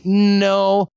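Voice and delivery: mildly exasperated contradiction; retold firm objection. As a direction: push back hard on a silly claim. Retold objection to blogger's claim.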